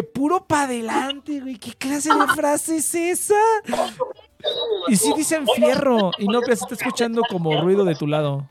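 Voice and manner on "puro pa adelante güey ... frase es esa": incredulous, higher-pitched, drawn-out exclamation; şaşkın tepki. Retold or imitated. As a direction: stretch it out in mock disbelief.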